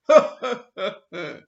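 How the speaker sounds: loud hearty villain laugh; robber's loud triumphant laughter; booming robber laugh. Acted. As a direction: let out a roaring belly laugh. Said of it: "brach in ein schallendes Gelächter aus".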